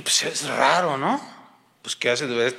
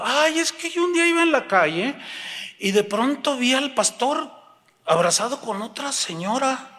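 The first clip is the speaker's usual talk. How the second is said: much higher-pitched mock woman; gossiping church sister; 8 semitones higher than usual. Hermanita explaining what she saw, high-pitched scandalized. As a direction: tell it as a scandalized witness.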